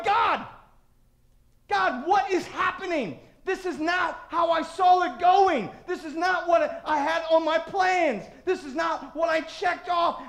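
loud, high mock protest; acted frustrated prayer, pitched high; exasperated cry to God. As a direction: cry out a bewildered complaint. Acts out complaint to God.

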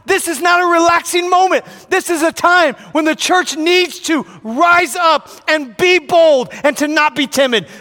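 high, loud, urgent sermon; rally the church to rise up; fired-up preacher exhorting the congregation; preaching at full force. Acted.

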